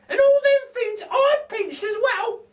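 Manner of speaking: very high, slow, chirpy lady voice; a cheeky cockney burglar lady; Burglar Betty. A narrator's reading. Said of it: "says Burglar Betty".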